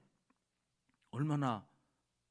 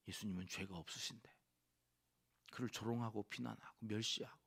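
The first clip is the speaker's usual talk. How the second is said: ordinary delivery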